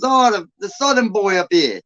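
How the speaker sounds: high-pitched imitation of a Long Island local; Long Island accent. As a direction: tease him in a put-on Long Island accent. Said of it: "I can do, like, Long Island".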